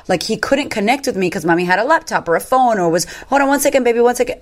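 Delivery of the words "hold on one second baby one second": put-on higher, hurried mum putting off a child; pitched-up brush-off to a little one; busy mother. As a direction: imitate a distracted parent stalling.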